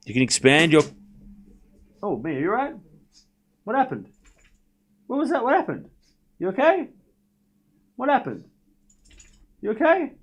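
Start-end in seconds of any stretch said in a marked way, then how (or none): 2.0-10.1 much higher, softer, concerned coo; high pet voice. Real, not acted. Pet startled by box.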